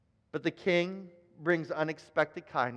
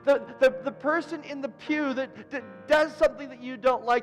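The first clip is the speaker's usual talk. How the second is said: rising, louder preaching build; 6 semitones higher, 3 dB louder than usual. Acted. Pastor urging the church, preaching at full force. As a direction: drive the warning home to the congregation.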